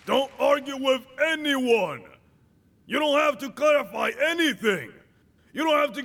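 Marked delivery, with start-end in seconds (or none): none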